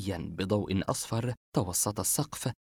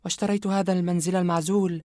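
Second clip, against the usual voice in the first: higher, cold female villain confessing; Yumna's voice; 9 semitones higher than usual. Acted. Yumna confessing her brother's story to Asem, cold and high. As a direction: calmly tell the dark tale, a sinister woman.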